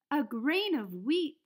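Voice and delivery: a little hen, slowly wondering aloud; musing little hen. A narrator's reading. The little red hen, musing to herself over the grain. Hen finds wheat, reading to children.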